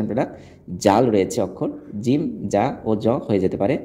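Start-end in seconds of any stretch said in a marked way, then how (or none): none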